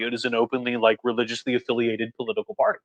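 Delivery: dropped to a soft aside. Real, not acted. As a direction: say it again under your breath. Restates the same point, quieter.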